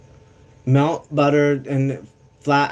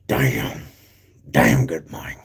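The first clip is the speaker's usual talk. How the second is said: deep mock voice; 11 semitones lower, much slower than usual. Mimicking Havoc deep voice.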